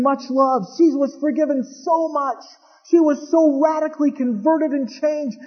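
high, fervent preaching. Acted. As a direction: drive the story home to the crowd. Preaching at full force.

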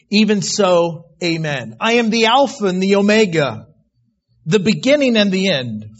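loud, ringing scripture proclamation; preaching. Acted. Preacher proclaiming the Lord's words to the congregation, loud. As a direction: declare it like thunder from the pulpit.

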